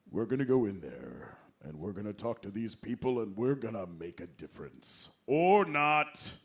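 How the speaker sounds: low, grand mock-earnest actor voice; Kelsey Grammer impression. Impersonating Kelsey Grammer at press, low grand mock gravitas. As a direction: boom the pep talk, then undercut it. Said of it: "he'd go".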